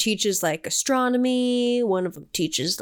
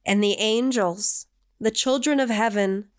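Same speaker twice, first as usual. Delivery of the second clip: slow, grave biblical recitation; solemn scripture reading; 2 semitones lower, slower than usual. Acted. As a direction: intone it like ancient scripture. Reads scripture aloud.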